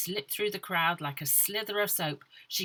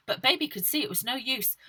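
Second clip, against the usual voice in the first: plain reading voice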